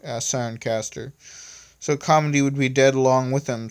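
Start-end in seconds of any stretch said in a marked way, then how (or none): none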